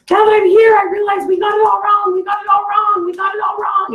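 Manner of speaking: high, loud panicked shout, imitating her; freaking out. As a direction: shriek it out like someone who just realized everything is wrong. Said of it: "she's, like, freaking out".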